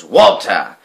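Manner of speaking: loud accusing call. A narrator's reading. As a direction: shout the dog's name. Uncle calling out blame.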